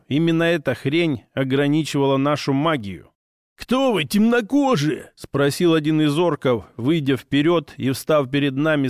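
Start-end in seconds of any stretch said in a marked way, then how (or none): 3.6-5.1 orc voice. Acted. Orc leader's voice.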